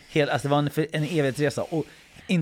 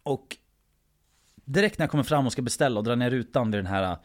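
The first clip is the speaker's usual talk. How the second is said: normal talking voice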